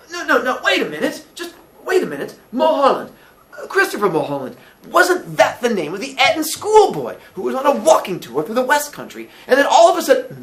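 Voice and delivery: high, loud, urgent Billy voice; excited, remembering Billy. Acted. Billy suddenly recalls the Eton schoolboy story, excited. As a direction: blurt it out as memory returns.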